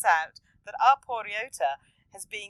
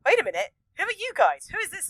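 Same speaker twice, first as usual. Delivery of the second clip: higher, louder startled cry; startled child seeing strangers; mock startled; 10 semitones higher, 5 dB louder than usual. Young Ryota seeing hooded figures, startled cry. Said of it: "he's like".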